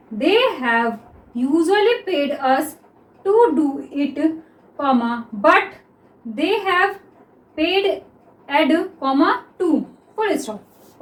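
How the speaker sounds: slow, word-by-word dictation of the sentence. Shown as a demonstration. Teacher reads the sentence slowly to students, slow dictation. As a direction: read it out slowly so students can write. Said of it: "देखिए".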